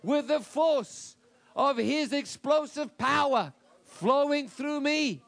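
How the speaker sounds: triumphant slow proclamation; shouted declaration. Shown as a demonstration. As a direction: bellow it as a victory cry.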